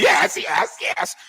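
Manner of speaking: high squeaky mimicked yeses; mock eager interrupting. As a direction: parody a fawning co-host in falsetto.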